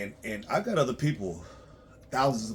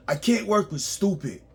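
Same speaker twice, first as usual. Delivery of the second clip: louder repeat; 9 semitones higher, 6 dB louder than usual. Real, not acted.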